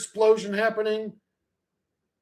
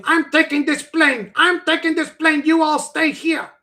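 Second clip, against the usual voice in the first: mock hijacker command; 6 semitones higher, 5 dB louder than usual.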